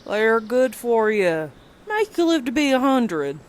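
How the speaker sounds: put-on patient old brother, unbothered; calm old man. A narrator's reading.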